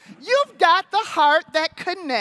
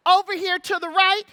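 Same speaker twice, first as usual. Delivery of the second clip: ordinary delivery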